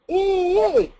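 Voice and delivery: ee from below the pencil, larynx up; pressed ee. Shown as a demonstration. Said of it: "I have them compare those two".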